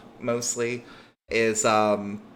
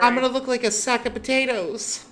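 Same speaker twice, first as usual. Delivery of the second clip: high-pitched, petulant protest; whiny complaint; 13 semitones higher, 4 dB louder than usual. Acted. Girl complains about the uniform, high and petulant. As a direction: whine it high in dismay.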